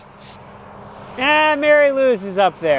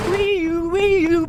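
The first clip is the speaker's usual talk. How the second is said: siren noise; 10 semitones higher than usual. Pretend.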